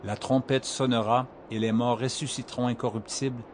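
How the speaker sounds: quiet solemn reading. Acted. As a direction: read it gently, almost hushed. Quieter passage of scripture reading.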